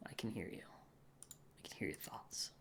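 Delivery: slow breathy whisper, mock-ominous; spooky whisper. Streamer teasing the chat about their thoughts, spooky whisper. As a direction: whisper it like a creepy mind reader.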